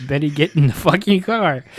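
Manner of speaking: panicked. Retold or imitated. Acting Barney panicking.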